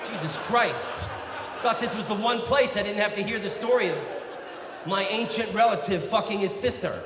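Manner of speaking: loud, high, fast outburst of complaint; mock-outraged relative fed up with the story; exasperated rant. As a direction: shout it like you've heard it every Christmas. Acts an exasperated relative of Byron.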